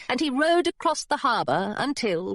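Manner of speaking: ordinary delivery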